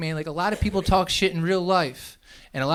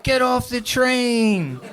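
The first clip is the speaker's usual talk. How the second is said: high, drawn-out angry heckle; yelling; 8 semitones higher, 3 dB louder, much slower than usual. Retold or imitated. Guy on the train yelling at the band, angry yell. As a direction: yell it over the band, stretching the words. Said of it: "yelling at them".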